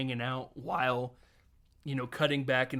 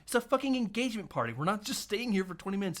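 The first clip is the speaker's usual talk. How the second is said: mock scolding; 6 semitones higher, faster than usual. Acted wife scolding husband.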